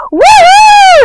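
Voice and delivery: very high, loud whooping cheer; thrilled whoop. Retold or imitated. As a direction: whoop it out in sheer delight. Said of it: "so thrilled and excited".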